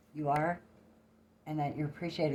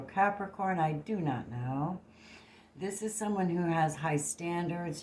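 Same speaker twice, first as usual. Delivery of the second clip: ordinary talk, nothing marked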